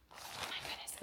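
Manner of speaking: hushed, breathy awe under her breath; breathless whisper. Real, not acted. Whispering in awe while unboxing a gift from a friend. Awed opening package.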